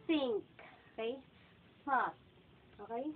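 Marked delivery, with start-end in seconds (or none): 0.1-0.5 "think" said loud and high with TH up front; punched-out "think", tongue between teeth for TH; TH sound demo. Shown as a demonstration. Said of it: "magpo-pronounce ka po ng T H sounds". 1.8-2.1 "Thought" said loudly with TH up front; tongue between teeth to open "Thought"; TH sound demo. Shown as a demonstration. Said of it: "magpo-pronounce ka po ng T H sounds".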